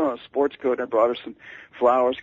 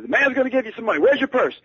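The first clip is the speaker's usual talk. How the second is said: high, loud, brusque demand; high-pitched, loud impersonation of a famous singer on the phone; 10 semitones higher than usual. Retold or imitated. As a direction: bark it down the phone like a star. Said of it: "shouting so loudly".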